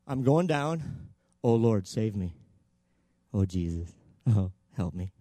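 very low, unhurried, half-hearted plea; insincere mock plea. Shown as a demonstration. Preacher showing how Peter would not have prayed, half-hearted mock plea. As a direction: pray as if sinking hardly matters. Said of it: "How many think he didn't do it like this".

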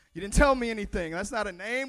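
frustrated retort. Pretend. Moses frustrated with God.

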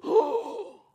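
high gasped oh of alarm; a startled high gasp; shocked gasp. Retold or imitated. Pastor grabbing his wrist, high shocked oh. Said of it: "the pastor grabs my wrist and goes".

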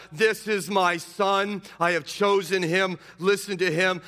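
loud, slow and high pronouncement; divine proclamation. Acted. God speaking to the disciples, loud and slow. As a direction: declare it as the voice of God. Voice from the cloud.

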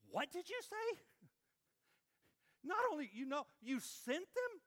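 a prophet who can't believe his ears; indignant disbelief. Retold or imitated. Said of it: "He's irritated, he's frustrated".

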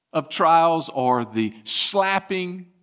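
ordinary talk

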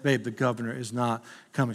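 hushed, gently calming the sleeping wife; quiet late-night reassurance; patient husband. Retold or imitated. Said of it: "I bet".

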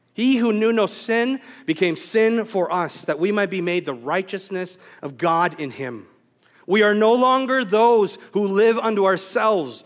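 fervent prayer building to loud, preacherly force; measured phrases, key words driven hard; public prayer. Real, not acted. Pastor prays to the Father after his sermon, fervent.

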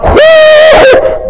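long high whoop of effort; drawn-out high-pitched "whoo"; exaggerated "whoo". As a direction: blow out a big mock-strained whoo. Acting out God straining and sweating.

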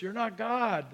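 ordinary talk

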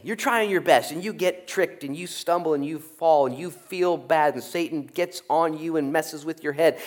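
usual speaking voice

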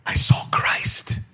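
slow hushed whisper of a revelation. Retold or imitated. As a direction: breathe it like a secret.

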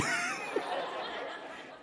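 short breathy chuckle; soft laugh. Real, not acted. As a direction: laugh quietly to yourself. Chuckles at delivery guy.

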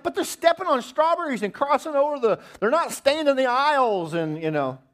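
high, loud excuse-making; defensive whine. Retold or imitated. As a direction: protest that the kids were misbehaving. Defending himself to wife.

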